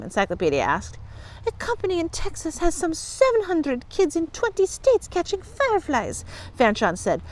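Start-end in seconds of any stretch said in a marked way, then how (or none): 1.5-6.2 put-on high-pitched girl explaining; earnest girl client. A narrator's reading. Fanchon explains the firefly business, earnestly. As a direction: explain it in a high, young girl's voice.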